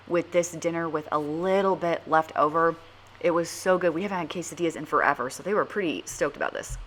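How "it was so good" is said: normal talking voice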